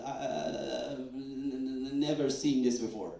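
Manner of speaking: imitating stammer. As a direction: stumble over every start. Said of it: "he was speechless".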